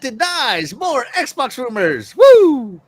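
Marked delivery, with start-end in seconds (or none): 2.2-2.8 loud high whoop; excited cheer. Real, not acted. Cheering stock news.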